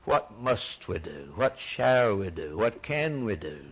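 normal talking voice

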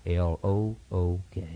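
"L O O K" spelled letter by letter, slow and soft; spelled out slowly. Shown as a demonstration. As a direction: spell "L O O K" out deliberately. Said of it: "one word and two letters".

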